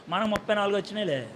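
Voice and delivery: quick, quiet line through a laugh; laughing boast. Retold or imitated. Failed student laughs off his own low marks to his friends, chuckling. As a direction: toss it off chuckling. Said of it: "అనుకో".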